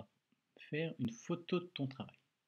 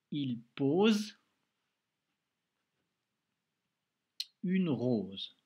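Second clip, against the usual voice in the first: slow, spaced-out, a bit louder dictation phrase; slow dictation reading; 2 semitones higher, 6 dB louder, much slower than usual. Shown as a demonstration. Teacher repeats the dictation sentence for a pupil, slowly. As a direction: stretch each word so the child can write. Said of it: "Eh ben, c'est la première lettre".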